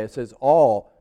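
"all" set apart with firm weight; stress on "all". Shown as a demonstration. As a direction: finish firmly on "all". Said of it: "I got an emphasis on the word all".

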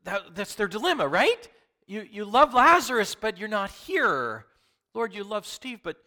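loud, high preacher's cry; full-force preaching. Acted. Pastor pressing the congregation, loud preaching. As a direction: preach it out to the whole room.

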